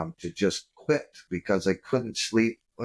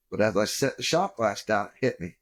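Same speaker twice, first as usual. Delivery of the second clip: ordinary talk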